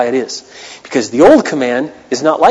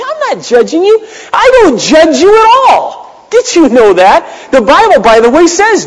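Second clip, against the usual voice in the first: higher and louder, the fiery pulpit voice; full-force preaching; 13 semitones higher, 5 dB louder than usual. Acted. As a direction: preach it out, slow and ringing on each line. Pastor preaching, insisting.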